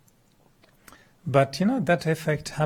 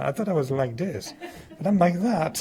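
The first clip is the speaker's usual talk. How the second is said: amused dismay; 4 semitones higher than usual. Retold or imitated. Acts shock at photo, laughing.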